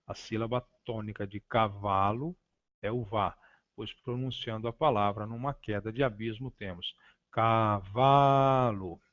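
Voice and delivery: cavalo with the vá stretched and falling; stressed syllable. Shown as a demonstration. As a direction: let the vá of cavalo drop as if over a cliff. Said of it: "A sílaba tônica é aquela que se estende". (cavalo com o vá alongado e caindo)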